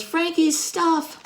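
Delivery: high, slow puppy voice; high delighted puppy; dog voice. Acted. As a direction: coo it like a happy little dog. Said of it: "And Frankie says".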